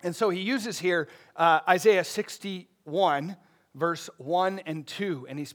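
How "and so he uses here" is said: ordinary talk, nothing marked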